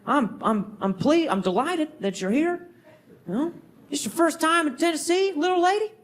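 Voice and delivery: high, lightly voiced Southern clerk; Southern accent. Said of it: "the guy at the front was like".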